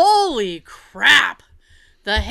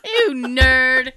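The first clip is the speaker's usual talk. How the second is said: teasing through laughter; laughing; 3 semitones higher, 5 dB louder than usual. Real, not acted. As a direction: laugh it out at him. Laughing tease of husband.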